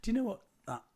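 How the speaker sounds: high and loud burst; excited. Real, not acted. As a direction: jump in excitedly. Interrupting excitedly.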